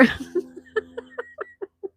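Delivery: breathy high laughter; high airy laugh. Real, not acted. Laughing at chat joke.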